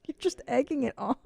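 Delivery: ordinary delivery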